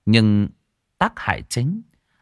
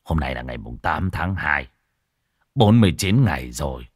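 grave monk; 4 dB louder than usual. Acted. Monk explaining the forty-nine days to the wife, grave. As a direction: count out the days with heavy dread.

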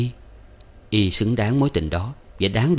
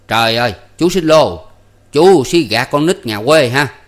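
fierce scolding; 5 semitones higher, 9 dB louder than usual. A narrator's reading. Uncle accusing driver of cheating his niece, fierce. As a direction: berate him at full voice. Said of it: "thấy bộ Hai Lọt dữ tợn".

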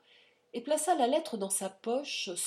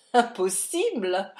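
rire nerveux; 9 dB louder than usual. A narrator's reading. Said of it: "en éclatant d'un rire nerveux".

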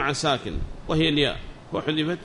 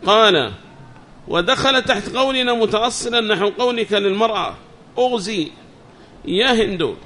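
higher, louder recitation of a book; reading text aloud; 5 semitones higher, 6 dB louder than usual. Acted. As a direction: read the text out to the class, raised and measured. (قراءة المتن بصوت مرتفع)

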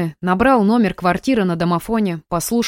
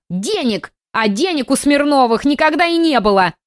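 put-on high old lady scoffing; scornful old woman; 7 semitones higher than usual. A narrator's reading. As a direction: scoff like a cranky granny.

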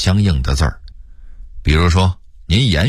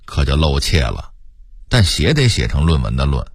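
usual speaking voice

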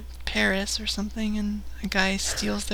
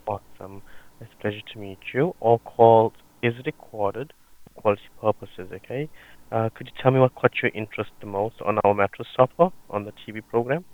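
call center agent; 11 semitones lower, 4 dB louder, much faster than usual. Acted. Prank call, plays sales agent.

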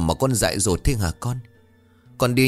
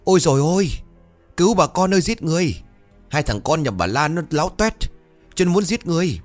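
high, loud cry of alarm; acted scandalised yelling, pitched way up; hollering for help; 10 semitones higher, 3 dB louder than usual. A narrator's reading. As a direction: shriek to the neighbours as you flee. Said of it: "vẫn không quên gào lên".

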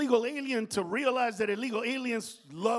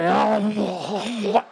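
mock gasp; 11 dB louder than usual. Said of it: "pop up like".

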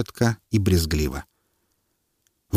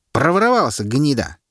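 heckler; 6 semitones higher than usual. A narrator's reading. Heckler jeering the thieving official, angry. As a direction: spit the insult out.

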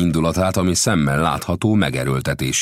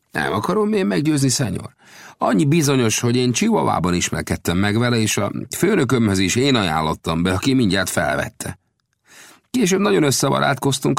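higher-pitched voice for the gambusino, earnest storyteller; gambusino's voice; 9 semitones higher than usual. A narrator's reading. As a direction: tell the tale as the friendly gold digger. Gambusino continues.

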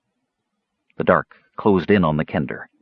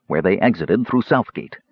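usual speaking voice

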